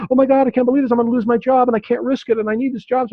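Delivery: high-pitched, rushed mock panic; acted-out fearful inner voice, high and racing; afraid. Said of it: "part of you that is afraid speak".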